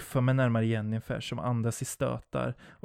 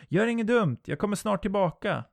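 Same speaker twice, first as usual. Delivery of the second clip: calling out; 9 semitones higher than usual. Acted. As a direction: shout back across the distance. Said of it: "formar händerna runt munnen och ropar". (ropar högt genom kupade händer)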